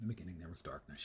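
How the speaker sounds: hushed mock narration whisper. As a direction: breathe it like a secret. Echoing game narration in whisper.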